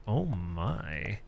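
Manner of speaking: very soft, low mock "Oh my"; mock flirtatious. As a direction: breathe it coyly as Greg. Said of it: "he's looking at me like".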